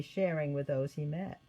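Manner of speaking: plain reading voice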